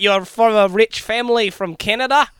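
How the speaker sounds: Australian accent, exaggerated; mock Aussie backpacker. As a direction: play the lazy Aussie charmer. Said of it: "but I talk like this".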